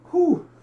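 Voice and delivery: sharp high exhale of relief; high breathy whew. Real, not acted. Recovering from coughing.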